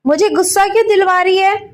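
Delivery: high, loud angry snap; exasperated mother-in-law shouts; angry outburst. Acted. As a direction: finally lose it and yell at her. Mother-in-law finally loses temper.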